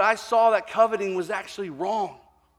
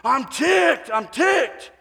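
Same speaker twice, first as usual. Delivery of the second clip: higher, louder furious shout; angry shout; 9 semitones higher, 7 dB louder, much slower than usual. Retold or imitated. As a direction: yell it twice, outraged. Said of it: "he said some other words".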